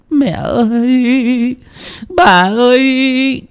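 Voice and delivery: high, slow sobbing cry. A narrator's reading. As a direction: drag out the call through tears. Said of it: "thút tha thút thít". (tiếng khóc thút thít)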